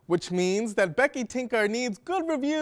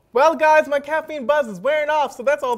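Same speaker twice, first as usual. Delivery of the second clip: overexcited; 6 semitones higher, 8 dB louder than usual. Acted. Host wilting from caffeine.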